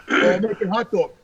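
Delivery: low, clueless, matter-of-fact reply; dopey roommate voice. Retold or imitated. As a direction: play the oblivious roommate, voice dropped low.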